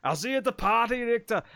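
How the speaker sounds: mild Austrian action-hero voice; Arnold Schwarzenegger impression. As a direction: quote it with a hint of an Austrian accent. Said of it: "And he's like".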